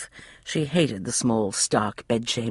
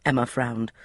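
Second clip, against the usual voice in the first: ordinary delivery, nothing marked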